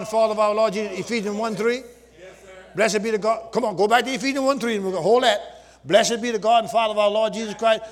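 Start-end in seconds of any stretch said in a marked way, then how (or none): none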